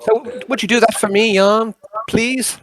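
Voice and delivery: put-on high-pitched old-chap character; wheedling character voice; Nixius, pleading. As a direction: plead politely with a pal.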